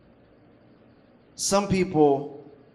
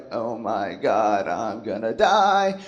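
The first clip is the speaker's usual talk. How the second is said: low, slow, hammed-up groaning despair; mock despair; 6 semitones lower, slower than usual. Stressed person sitting while life happens, in mock despair. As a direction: drag it out in a low, doom-laden voice.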